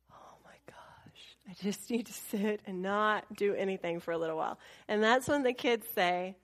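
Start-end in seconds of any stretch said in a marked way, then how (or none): 0.1-4.6 exhausted whisper. Real, not acted. Mom after the landlady leaves, exhausted whisper. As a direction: sigh it out, spent. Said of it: "exhausted whispered relief".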